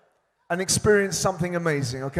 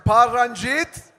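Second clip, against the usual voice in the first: calling out; 7 semitones higher than usual. Real, not acted. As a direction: shout each name to the crowd. Calling out names to crowd.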